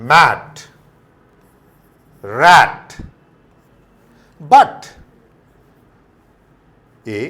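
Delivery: "cat", "bat" with short flat "a", called very loud; loud short-a drill. Shown as a demonstration. Teacher drilling short-a words, loud call-outs. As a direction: bark each word for the class. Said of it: "वो ए हो जाता है".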